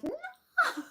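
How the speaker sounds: high, laughing cry of alarm; retold shocked shout. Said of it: "and I shout".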